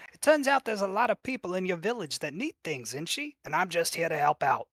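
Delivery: slick hustler bird, pitch jumping up; wheedling crow character. Acted. Crow accused of stealing money, smoothly defensive. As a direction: protest innocence like a small-time fixer. Game master plays the scheming crow.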